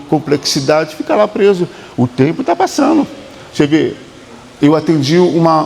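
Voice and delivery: ordinary talk, nothing marked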